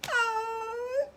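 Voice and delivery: high, drawn-out tearful "oh"; hammed-up happy-tears cry; mock crying wail. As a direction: wail it high like an overcome mama. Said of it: "And she started crying".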